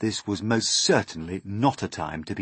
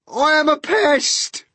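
slow, very high, loud announcement; gleeful centipede; 12 dB louder, slower than usual. Acted. Centipede announcing to the room, gleeful boast. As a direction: trumpet it with a broad grin. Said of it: "the centipede announced, grinning broadly".

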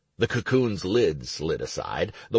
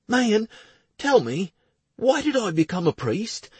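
put-on higher, lively young priest; young man voice; 13 semitones higher than usual. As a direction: voice Clowder up and eager. Clowder voiced.

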